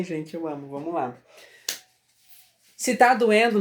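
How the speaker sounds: usual speaking voice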